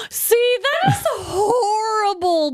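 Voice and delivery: high, slow, near-shouted mock anguish; hammed-up dismay. Real, not acted. Host reacting to the crying girlfriend, high slow mock wail. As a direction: wail it with half-crying horror.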